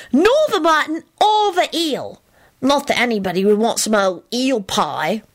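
put-on high, emphatic child's warning; stern little boy. A narrator's reading. Boy warning mother off eel pie, stern. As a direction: lecture firmly in a high child voice. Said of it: "Teddy instructed Elizabeth sternly".

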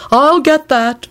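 high-pitched scolding servant. A narrator's reading. Servant stopping the guest holding a body aside, scolding. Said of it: "Barnabas scolded".

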